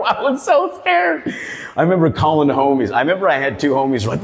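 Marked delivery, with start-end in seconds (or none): 0.0-1.3 high slow mock fear. Recalling fear.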